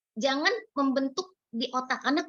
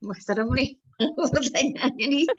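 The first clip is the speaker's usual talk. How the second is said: laughing through "serem nih"; a nervous joking laugh; 2 semitones higher than usual. Real, not acted. Joking about scary question. (sambil tertawa gugup)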